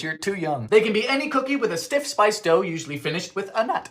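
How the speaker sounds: ordinary talk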